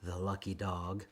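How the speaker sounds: hushed secret whisper. A narrator's reading. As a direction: whisper it behind your hand. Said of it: "but others whispered".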